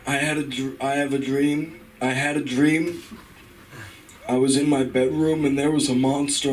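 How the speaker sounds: Biden ad-libbing a dream speech; Joe Biden impression. Comic does Biden giving the dream speech, lightly voiced. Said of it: "Joe Biden, giving the Martin Luther King "I Had a Dream" speech".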